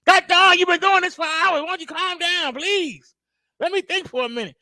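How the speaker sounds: high, loud exasperated plea; high strained frustrated pleading. Retold or imitated. Frustrated caregiver voiced.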